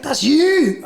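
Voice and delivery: high, mock-amazed exclamation; excited recognition. Police spotting him in the video, excited imitation.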